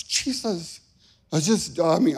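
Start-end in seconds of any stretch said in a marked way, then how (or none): 0.0-0.8 higher, softer mock-desperate cry; retold desperate plea, pitched up. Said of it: "all I could get out of my mouth".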